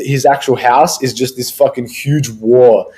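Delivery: ordinary talk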